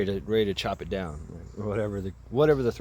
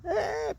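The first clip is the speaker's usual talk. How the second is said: high, thin squeal; imitated high-pitched freak-out noise; mock panicked squeal. People freaking out at burning gum.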